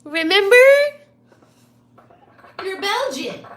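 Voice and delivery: loud, high playful reminder; teasing call-out. Real, not acted.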